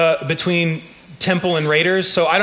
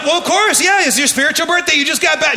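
cheery agreement; 7 semitones higher than usual. Retold or imitated. Churchgoers answering the birthday news, high and cheery. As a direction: humor her warmly and brightly. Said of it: "we're like".

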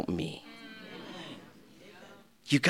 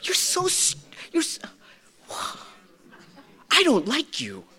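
self-disgust; 10 semitones higher, 4 dB quieter than usual. Retold or imitated. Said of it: "we look at ourselves in the mirror and go".

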